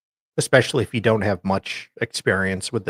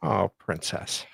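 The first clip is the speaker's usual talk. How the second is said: condescending oh princess; 3 semitones lower, 10 dB quieter, slower than usual. Pretend. Slow mock endearment.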